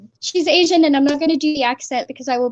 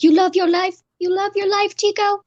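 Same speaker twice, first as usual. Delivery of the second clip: higher mock threat to husband; playful raised-pitch challenge; scolding wife; 6 semitones higher than usual. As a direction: imitate an aggravated wife, pitched up. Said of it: "be like".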